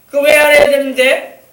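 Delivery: put-on loud, high protest of a defiant child; imitated back-talk. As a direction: act out a kid talking back to a parent. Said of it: "말대꾸 딱딱하면서 엄마한테 말이야".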